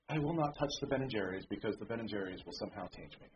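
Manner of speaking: low, soft mock vow; mock-pious aside. Preacher parodying someone shunning ice cream, mock-pious. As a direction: drop low and quiet for a mock solemn vow.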